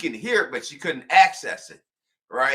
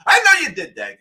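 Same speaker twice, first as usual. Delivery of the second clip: higher, louder accusing callout; mock scolding; 7 semitones higher, 9 dB louder than usual. Real, not acted. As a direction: point the finger and call them out. Scolding parents.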